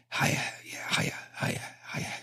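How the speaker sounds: hushed whispered greetings; awkward funeral whisper to a circle; mock funeral whisper. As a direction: whisper hello like at a wake. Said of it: "you have to whisper 'cause you're at a funeral".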